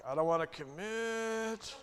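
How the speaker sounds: high, slow, whiny refusal; mock reluctant young person; reluctant Gen Z. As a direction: drag it out like a bored teen. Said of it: "Now you're acting like Generation Z".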